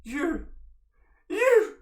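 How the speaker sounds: high, loud rising cries; excited shout. Acted. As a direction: call out in sudden recognition. NPC recognizes Nalna.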